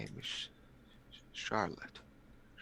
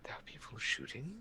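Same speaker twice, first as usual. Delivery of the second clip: very soft whispered question; faint, fearful whisper; hushed alarm; 3 semitones higher, 15 dB quieter than usual. Acted. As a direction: whisper it in shaken disbelief. Shaken character learns of gunfire.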